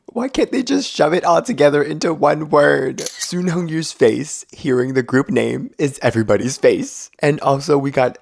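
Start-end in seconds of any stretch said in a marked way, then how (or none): none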